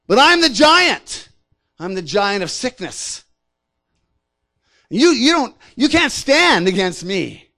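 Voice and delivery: booming, slow boast, pitched high on key words; loud, drawn-out challenge from a towering bully; intimidating giant. Acted. Giant of sickness challenging its victim, intimidating. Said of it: "uses that to intimidate us".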